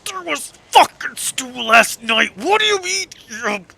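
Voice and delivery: high, loud furious rant; old man losing his temper, pitch climbing; getting so angry. Acted. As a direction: rise into an incredulous, outraged squawk. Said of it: "just getting so angry".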